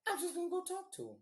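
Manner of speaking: very high, quick mock-innocent voice; feigned innocence. Boyfriend telling girlfriend he'll just talk, high mock innocence. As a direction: brush it off in a squeaky voice.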